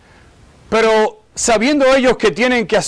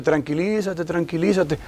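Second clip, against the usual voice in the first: soft, lower calming voice; soothing wife; 5 semitones lower, 8 dB quieter than usual. Retold or imitated. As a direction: gently talk someone down, imitated. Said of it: "Y Megan dice". (voz bajita que tranquiliza)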